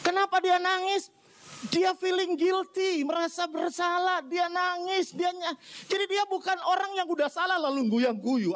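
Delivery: high-pitched, insistent sermon delivery; impassioned preaching. Real, not acted. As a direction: drive the point home from the pulpit. (khotbah berapi-api)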